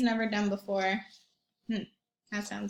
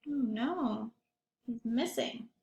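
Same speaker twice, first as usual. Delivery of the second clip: worried hush; 6 dB quieter, slower than usual. Acted. Boy worried about lost friend.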